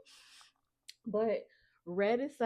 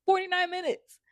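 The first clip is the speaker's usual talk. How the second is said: incredulous; 10 semitones higher, 6 dB louder than usual. Real, not acted. Amazed at video length.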